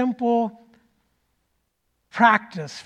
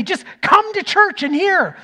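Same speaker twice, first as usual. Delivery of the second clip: exasperated urge; 10 semitones higher, 7 dB louder than usual. Retold or imitated. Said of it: "And I wanted to say".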